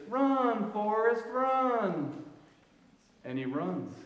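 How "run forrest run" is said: higher urgent call, lightly voiced; Jenny calling out. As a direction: call him to run, pleading. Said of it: "she says".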